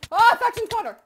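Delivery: loud, high call across the map. Real, not acted. Calling out loudly to Factions Connor in the game. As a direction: shout his name to get his attention.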